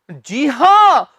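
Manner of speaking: ordinary delivery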